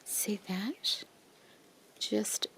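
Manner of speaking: hushed whisper of admiration. Real, not acted. Showing lace to viewers, whispering. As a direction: breathe it like a secret.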